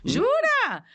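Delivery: high, loud burst of disbelief; astonished. Real, not acted. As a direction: blurt it out in amazed surprise. Surpresa com a resposta do médico. (muito surpresa, incrédula)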